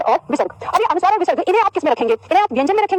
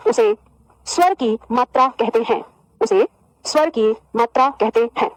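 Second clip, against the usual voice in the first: slow, chunked dictation with pauses; feed the class each phrase to write down; unhurried dictating teacher; 2 semitones lower, slower than usual. Shown as a demonstration. Dictating definition slowly.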